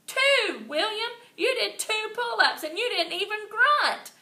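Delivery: high, loud, overjoyed yell; girl cheering a classmate's pull-ups; thrilled holler. A narrator's reading. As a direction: cheer him on at the top of your lungs. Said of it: "I hollered, very thrilled".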